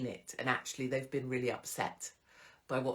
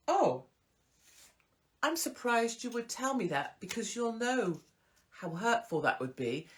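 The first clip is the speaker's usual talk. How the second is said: pointed reply; 7 semitones higher than usual. Shown as a demonstration. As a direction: turn it back on her, pitched up and deliberate. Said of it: "little Miss Bitchface".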